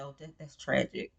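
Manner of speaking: mock sympathy, barely bothering to say it; a sarcastic aside, dry and low-key; deadpan sarcasm. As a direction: drop it to a dry near-mutter. Mocking the couple in a quote.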